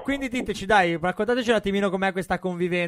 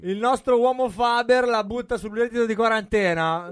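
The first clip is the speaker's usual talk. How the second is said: announcer voice; 5 semitones higher, 5 dB louder than usual. Pretend. Host hypes caller on air.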